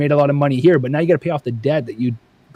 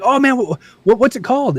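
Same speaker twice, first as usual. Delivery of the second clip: eager fan; 8 semitones higher than usual. Retold or imitated.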